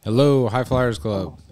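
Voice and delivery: slow, drawn-out radio host greeting; radio contest promo. Pretend. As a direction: announce it like a DJ on air. Hosts a radio call-in contest.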